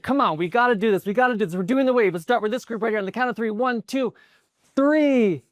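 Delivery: rally them with insistent high energy; urgent rallying. Retold or imitated. Kid pleading with stadium section, urgent and excited. Kid urging the crowd again.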